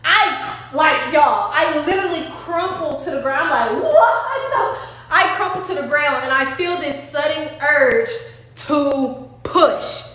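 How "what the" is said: startled. Retold or imitated. Crumpling to the ground.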